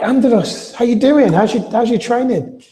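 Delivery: put-on high, bright hello to an old gym member; delighted greeting. [name] greeting Andros back at the gym, high and delighted. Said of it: "and I'm like".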